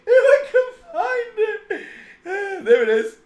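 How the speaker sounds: high, laughing through the words; giggly searching; laughing while talking. Real, not acted. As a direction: struggle to speak for laughing.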